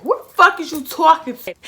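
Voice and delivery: loud, high-pitched angry retort; snapping back. Retold or imitated. Woman retelling how she snapped back at her date, snapping. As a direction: snap back at him, voice shooting up. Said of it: "I was snapping back".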